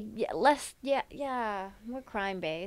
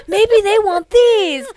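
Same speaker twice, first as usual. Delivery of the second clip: much higher and louder, slow, laughing; excited offer; 13 semitones higher, 13 dB louder, slower than usual. Retold or imitated. Spike offering steaks to loan sharks, loud and giddy.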